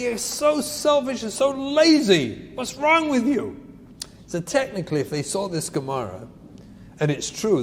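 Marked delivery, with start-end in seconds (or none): 0.0-3.6 a higher, scolding parent's snap; mock angry parent. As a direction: scold the lazy kid, half-acted. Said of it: "And you go over and say".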